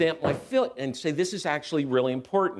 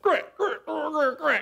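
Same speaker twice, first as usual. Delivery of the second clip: high, squeezed mock cry; comically strangled high voice; strained calling; 13 semitones higher, slower than usual. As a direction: call out helplessly in a choked squeal. Father calls after his son.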